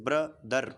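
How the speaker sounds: "ब्रदर" in two separated beats; syllable by syllable. Shown as a demonstration. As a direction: break "ब्रदर" after its first syllable. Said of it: "ऐसे ही". ("ब्रदर" को दो हिस्सों में तोड़कर)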